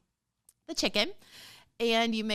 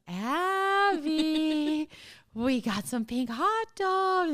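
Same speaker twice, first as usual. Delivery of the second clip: slow, drawn-out retold enticement; teasing coaxing; 5 semitones higher, much slower than usual. Mom tempting crabby Abby with hot dogs, slow teasing coaxing. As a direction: dangle the treat in a stretched call.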